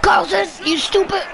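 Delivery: high shouted insult; yelled taunt. Real, not acted. Boy shouting an insult at the rival player. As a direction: yell at the player on screen.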